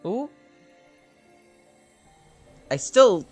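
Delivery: usual speaking voice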